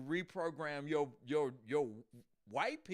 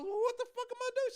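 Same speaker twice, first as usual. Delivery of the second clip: fast, very high mock panic; flustered; 4 dB louder, faster than usual. Man asks what to do without slaves, high and flustered. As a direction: blurt it out high, hammed-up bewilderment. Acted panicked question in skit.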